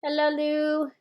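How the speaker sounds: high, sustained put-on posh English greeting; mock posh English. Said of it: "Yes, posh English".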